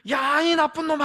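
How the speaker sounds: furious yell, loud and high. A narrator's reading. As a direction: scream it back even harder in anger. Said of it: "화가 나가지고 더 크게".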